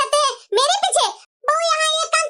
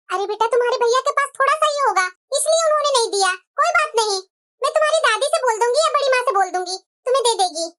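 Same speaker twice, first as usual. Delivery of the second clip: ordinary delivery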